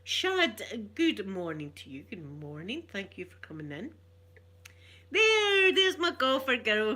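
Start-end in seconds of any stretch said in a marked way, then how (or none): 5.1-6.9 bright, much higher and louder hello; light up as she spots her friend in the chat; excited greeting. Real, not acted. Greeting Golfer Girl as she joins, delighted. Greeting a viewer joining the stream.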